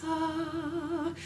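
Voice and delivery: high, very soft airy ah; breathy ah. Shown as a demonstration. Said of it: "breathy as possible".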